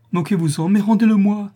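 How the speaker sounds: rising anguished plea; pleading cry. Acted. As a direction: beg loudly for it back. Mother pleads for bonnet.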